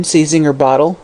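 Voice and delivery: ordinary delivery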